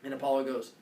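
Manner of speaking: usual speaking voice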